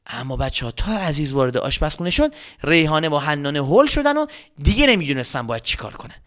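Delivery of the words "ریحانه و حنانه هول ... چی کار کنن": plain reading voice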